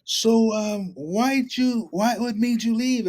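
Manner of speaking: elder Dave, eager to talk; eager old elder. Retold or imitated. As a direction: sound thrilled to win him back. Dave eager, voiced higher.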